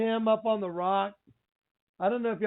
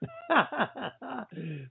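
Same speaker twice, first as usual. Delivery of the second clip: real burst of higher, softer laughter; sheepish laugh; 4 semitones higher, 5 dB quieter than usual. Real, not acted. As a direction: laugh at your own late spoiler alert. Laughs after admitting a spoiler.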